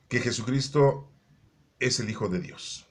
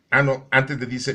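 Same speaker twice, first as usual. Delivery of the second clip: ordinary delivery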